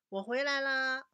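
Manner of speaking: put-on child shouting hello to mother on arriving home; child calling home. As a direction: call it out like a kid bursting through the door.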